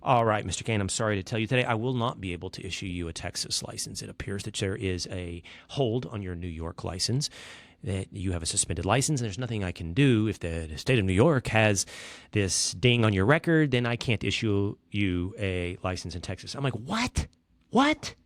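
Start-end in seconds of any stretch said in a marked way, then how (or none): 0.0-16.5 lower, even, friendly clerk; measured, low bureaucratic apology; same friendly tone. Retold or imitated. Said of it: "in the same tone of voice". 16.8-18.1 high, rising what; incredulous question jumping up in pitch; retold shock, pitched higher; stunned disbelief. Stunned reaction to bad news.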